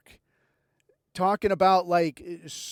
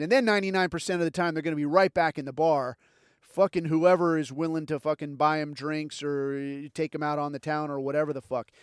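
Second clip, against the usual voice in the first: ordinary talk, nothing marked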